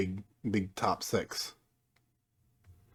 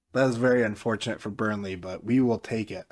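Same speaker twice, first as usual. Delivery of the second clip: usual speaking voice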